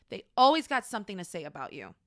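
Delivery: usual speaking voice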